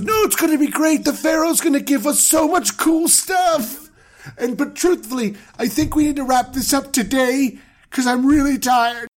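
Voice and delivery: high, fast dude-bro voice; high excited bro talking his wife into it; hyped bro. Pretend. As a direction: rush to hype her up. Abram reassuring wife, fast.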